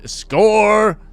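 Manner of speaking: loud, high victory yell; triumphant shout. Real, not acted. Gamer cheering his dating win loudly. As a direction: yell it like you just won. Celebrating rizz success.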